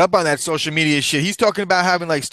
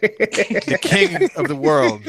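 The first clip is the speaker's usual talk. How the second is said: laughing; 9 semitones higher, much slower than usual. Acted. Mocking grand title.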